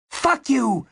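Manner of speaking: very high, explosive outburst; spat out in fury. A narrator's reading. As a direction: spit it at him. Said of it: "she spat out".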